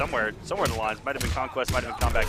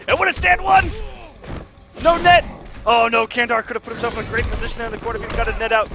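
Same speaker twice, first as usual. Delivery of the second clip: high, loud hype shout; excited shouting; 6 semitones higher, 3 dB louder than usual. Real, not acted. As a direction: yell it like a big play just landed. Caster shouts at big combo.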